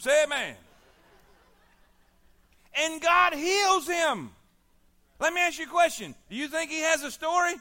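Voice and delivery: loud, high, fiery sermon build; full-force preaching. Acted. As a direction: preach it hard to the congregation.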